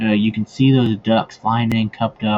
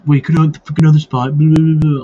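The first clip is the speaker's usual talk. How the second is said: higher, louder, indignant rant; angry brother; 5 semitones higher, 7 dB louder than usual. Retold or imitated. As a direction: whine it like a kid brother whose spot got taken. Brother angry about his hunting spot.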